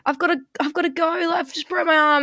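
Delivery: high, fast fake panic; mock panicked pupil. Pupil fooling the art teacher, high and hurried. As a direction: play the kid faking an injury.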